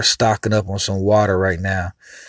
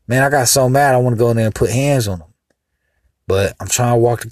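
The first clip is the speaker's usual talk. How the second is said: ordinary talk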